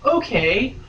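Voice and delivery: ordinary talk, nothing marked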